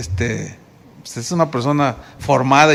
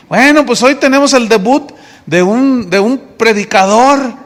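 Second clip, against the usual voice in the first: louder, announcer-like intro; announcing; 5 semitones higher, 5 dB louder than usual. Retold or imitated. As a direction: present the new preacher grandly. Pastor announces the debut. (presentación solemne, más fuerte)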